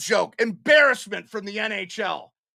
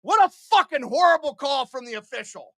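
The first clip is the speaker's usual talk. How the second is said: ordinary talk, nothing marked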